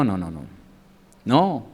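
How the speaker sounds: usual speaking voice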